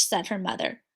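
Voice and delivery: ordinary delivery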